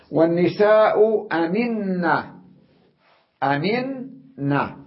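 أمنة drawn out slowly with a long doubled ن; slow stressed doubling. Shown as a demonstration. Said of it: "في عندي نونان". (تشديد النون في أمنة ببطء)

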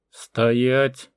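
loud, sustained order; forceful shouted command; barked command. A narrator's reading. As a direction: bark it out to halt a crowd. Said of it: "рявкнул Лешага".

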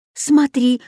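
high, urgent calling out; crying out. A narrator's reading. Katya spots a flying creature overhead, crying out. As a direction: point it out urgently, pitched well up. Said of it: "закричала Катя".

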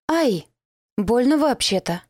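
yelp, then sulk at them; offended cry. Acted. Girl hits the floor, crying out offended at guards. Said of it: "вскрикнула обиженно".

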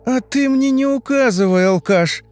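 high, loud, shrieked insult; angry retort. A narrator's reading. Sister fires back at her brother, angry shriek. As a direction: lash back at full pitch.

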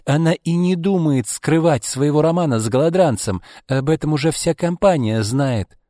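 high-pitched, scornful rant; heated tirade. A narrator's reading. Izolda attacks Arkady's children, in a heated tirade. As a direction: rail about the ungrateful children, pitched high. Acted tirade, beside herself.

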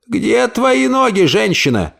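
high, slow impatient demand; lightly voiced aunt scolding; impatient old lady. Aunt commanding her body.

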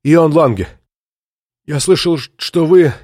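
gasping between words; panting, out of breath. A narrator's reading. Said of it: "чтобы восстановить дыхание".

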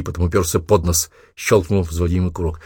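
plain reading voice

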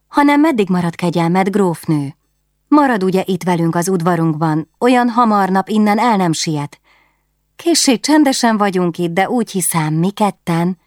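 a lonely queen delighted to chat at last; excited Katalin. Acted. Katalin begging the guest to stay.

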